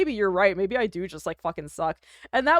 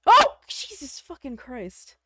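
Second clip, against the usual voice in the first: startled; 3 semitones higher, 4 dB louder, slower than usual. Real, not acted. Startled by a loud scream.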